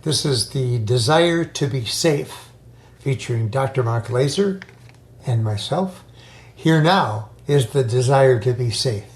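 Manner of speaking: upbeat announcer read over music; podcast intro. Acted. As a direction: introduce the show like a host.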